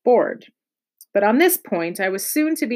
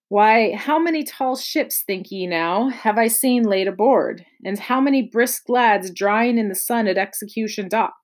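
ordinary delivery, nothing marked

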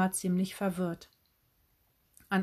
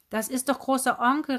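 higher, raised calling voice; rufend; 5 semitones higher, 4 dB louder than usual. A narrator's reading. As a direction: call out insistently as the aunt. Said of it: "rief sie". (aufgebracht gerufen)

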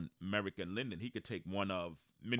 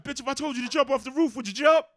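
high, loud and fast mock yell; retold angry yell; 13 dB louder, faster than usual. Friend yelling at his girlfriend, fast high loud yell. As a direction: rattle off the insult at full volume. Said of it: "He said".

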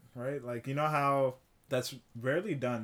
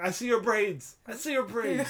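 high, loud, laughing call to the singer; excited fan call; 10 semitones higher, 5 dB louder than usual. Retold or imitated. A fan spots the singer's braids backstage, calls out high and laughing. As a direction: spot her and cheer with a laugh. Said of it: "I'm like".

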